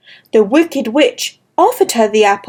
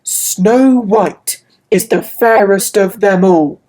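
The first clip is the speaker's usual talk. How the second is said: lower, grave mirror voice; magic mirror; 4 semitones lower than usual. Acted. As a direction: deliver it like an oracle. Magic mirror answers queen.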